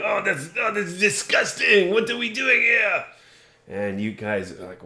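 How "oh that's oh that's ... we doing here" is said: high French chef yelling; outraged French cook, high pitched. Acted. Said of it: "He starts yelling".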